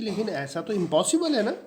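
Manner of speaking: astonished protest, voice jumping up; surprised. A narrator's reading. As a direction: gasp it out as if it cannot be true. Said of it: "Mrs Pearson बड़ी surprise है".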